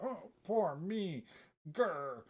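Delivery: put-on high, drawn-out whiny baby voice; self-mocking crybaby wail ending in a growl; mock self-pity. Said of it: "crying like a stupid little baby".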